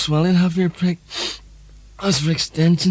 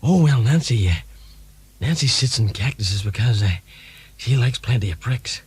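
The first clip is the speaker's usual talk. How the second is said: Ronald Reagan impression; 6 semitones lower than usual. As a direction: play a drowsy, forgetful Reagan. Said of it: "Ronald Reagan".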